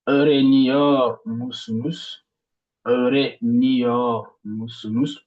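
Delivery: öğreniyor musunuz slowly, weight on öğreniyor; stress before musunuz. Shown as a demonstration. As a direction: lean on öğreniyor. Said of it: "l'accent tonique sur". (accent tonique sur öğreniyor, lentement)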